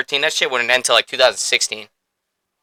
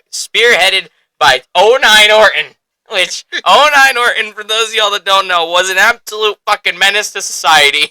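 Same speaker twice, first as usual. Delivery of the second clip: raised, louder hyped-up rant; excited hype; 7 semitones higher, 6 dB louder than usual. Real, not acted. Fan hyping his favourite villain era to listeners, excited and loud. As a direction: gush about the villain like a superfan.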